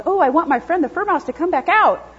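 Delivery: high, rising excited dog voice, gasping at the end; eager dog voice. Retold or imitated. Dog thrilled its fur mouse came back, high excited voice. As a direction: play the thrilled dog seeing its toy come back. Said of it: "And then the dog goes".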